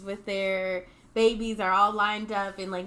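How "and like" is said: usual speaking voice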